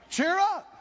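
high loud call; preacher answering his own question, high and loud; proclaimed cheer up. Acted. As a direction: ring it out.

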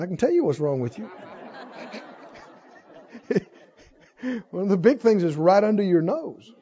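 usual speaking voice

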